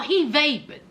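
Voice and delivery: ordinary talk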